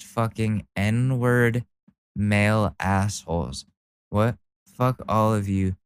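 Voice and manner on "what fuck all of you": ordinary delivery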